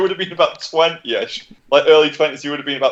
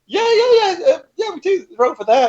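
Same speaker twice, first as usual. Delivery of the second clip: much higher, cracking through barely held-in laughter; stifled laughter. Retold or imitated. Clerk playing along with the prank while stifling a laugh. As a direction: try to keep a straight face and fail.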